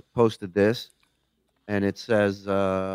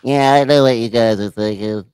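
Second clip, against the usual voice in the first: elf voice; 7 dB louder than usual. Retold or imitated. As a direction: mimic the helium-sounding elf guy. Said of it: "What's an elf voice doing in a normal sized body?".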